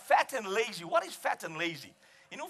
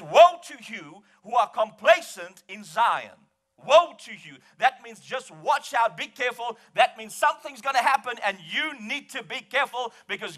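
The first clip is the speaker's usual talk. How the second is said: full-force preaching; 5 semitones higher, 7 dB louder than usual. Real, not acted. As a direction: thunder a warning to the congregation.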